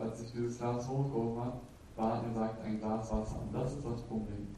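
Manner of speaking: normal talking voice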